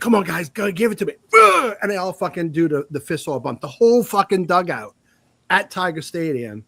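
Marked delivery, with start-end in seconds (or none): 0.0-1.1 fast, higher pumped-up call; rally the dugout, quick and high; hyped hitter. Retold or imitated. High school hitter firing up his teammates, in a fast, higher pumped voice. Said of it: "he's like". 1.3-1.8 very high, loud pumped-up grunt; hype grunt. Retold or imitated. Hitter celebrating with teammates, a loud high grunt.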